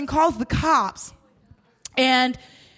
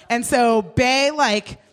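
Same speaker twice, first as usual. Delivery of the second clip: normal talking voice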